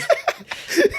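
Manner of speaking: a burst of high laughter. Real, not acted. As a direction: let a real laugh break out. Laughs after retold exclamation.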